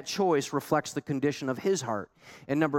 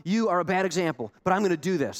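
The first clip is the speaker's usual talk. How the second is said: mock fired-up believer; 6 semitones higher, faster than usual. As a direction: play an eager convert hyping himself up. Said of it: "Jonah isn't gonna get you fired up and say".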